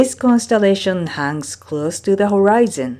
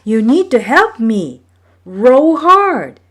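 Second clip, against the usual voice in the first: high and loud, pushing the brothers on; urgent command; 6 semitones higher, 6 dB louder than usual. A narrator's reading. Maui urging his brothers to row, loud command. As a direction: urge them to row with force. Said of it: "he told his brothers".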